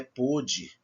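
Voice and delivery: pôde with closed stressed O. Shown as a demonstration. Teacher contrasting past with present, closed O in pôde. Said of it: "é diferente".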